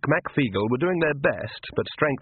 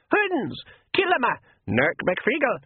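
much higher, shrill war whoop; pixie battle cry; 13 semitones higher than usual. Acted. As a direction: scream the charge like a tiny warrior.

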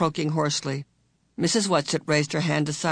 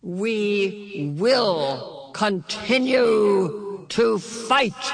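very slow, drawn-out, higher unearthly voice; otherworldly ringing voice; 5 semitones higher, much slower than usual. A narrator's reading. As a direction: ring out each word like a distant being. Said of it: "Something in Missus Witch's voice".

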